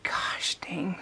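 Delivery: whispered under her breath; a whispered curse of frustration; frustrated whisper. Retold or imitated. Frustrated mutter after upsetting husband.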